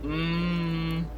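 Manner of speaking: normal talking voice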